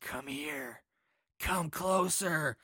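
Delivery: slow, hushed luring voice; creepy whisper. Retold or imitated. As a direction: lure him in, hushed and slow. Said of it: "a man's voice whispering".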